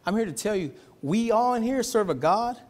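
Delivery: ordinary talk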